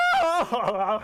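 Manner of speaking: loud, high staccato laughing; cartoon cackle. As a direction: burst into a hammed-up cartoon cackle. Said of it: "Squidward's just laughing".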